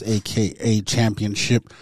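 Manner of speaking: ordinary talk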